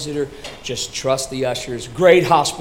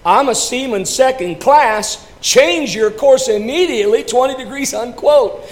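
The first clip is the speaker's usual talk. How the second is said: higher, louder insistent reply; calm firm reply; 10 semitones higher, 7 dB louder than usual. Acted. Seaman replying to the captain, raised and insistent. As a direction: hold your ground. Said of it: "comes the reply".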